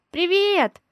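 high, loud greeting called from a distance; shouting from afar. Acted. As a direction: call it out from across the house. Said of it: "кричу издалека".

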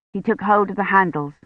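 ordinary delivery

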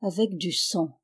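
réponse lente et grave; slow, grave reply. A narrator's reading. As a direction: let each word fall slowly. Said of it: "répondit lentement".

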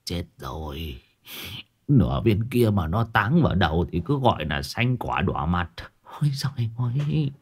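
softly muttered dismay; hushed worry to oneself; inner thought. A narrator's reading. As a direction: think aloud under your breath, starting very quiet and low. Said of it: "Đồng già nghĩ thầm trong bụng".

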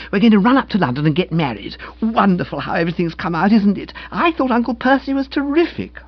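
high, quick, gushing feminine voice; excited young woman. Acted. Young woman announcing her wedding plans, excited. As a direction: bubble over with happy news.

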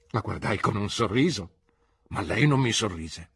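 plain reading voice

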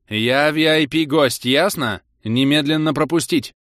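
slow, loud commanding bark; bossy bark. A narrator's reading. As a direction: pull rank at full volume. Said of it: "рявкнула Зара самым начальственным тоном". (начальственный рявк)

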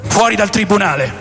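normal talking voice

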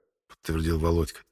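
plain reading voice

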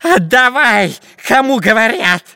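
high, loud shouted demand; spy's shouting. A narrator's reading. Long-nosed spy clutching the old chest, shouting. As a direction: yell like a grasping little villain. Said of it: "кричал длинноносый шпион Дырка".

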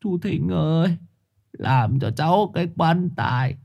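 high, drawn-out plea breaking into wailing; tearful wail. A narrator's reading. Uncle asks for a coffin for the dead boy, tearful wailing. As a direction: sob out the terrible news. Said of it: "Thưởng Báo lại trào nước mắt".

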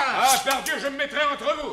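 high, loud protective cry; shouting to intervene. Acted. Father steps between captain and son, shouting. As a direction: throw yourself between them, shouting.

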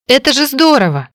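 high, delighted burst; glad exclamation. Acted. Heroine hurries to tell her brother her joy. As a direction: cheer it out with joy.

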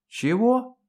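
high, rising startled question; dumbfounded. A narrator's reading. Colonel stunned by talk of marriage, dumbfounded. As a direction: blurt it out, taken aback. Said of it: "оторопел полковник".

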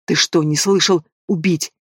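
plain reading voice

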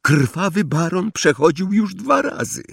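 high-pitched, choked protest; stifled cry. A narrator's reading. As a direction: plead in a high, strangled voice. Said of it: "zawołał Neville zduszonym głosem".

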